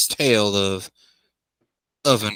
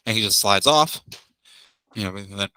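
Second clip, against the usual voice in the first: ordinary talk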